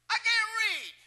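loud, high imitation of a man protesting; mimicked high-pitched holler; Virgil's voice. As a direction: blurt it high and loud as the punchline.

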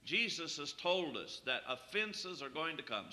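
usual speaking voice

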